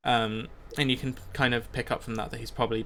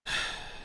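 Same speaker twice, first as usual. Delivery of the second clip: soft breathy sigh; 10 dB quieter than usual. Acted. Leader pausing before the crowd, soft sigh. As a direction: let out a quiet breath. Leader pauses mid-speech.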